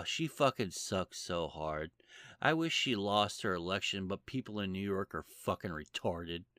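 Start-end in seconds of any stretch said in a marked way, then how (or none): none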